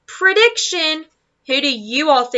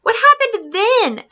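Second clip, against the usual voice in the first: put-on high, slow curious little boy voice; little boy asking; 9 semitones higher, 3 dB louder, slower than usual. Michael asks Wendy what happened next, high childlike voice. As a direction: ask it high and wide-eyed like a small child. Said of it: "asked Michael".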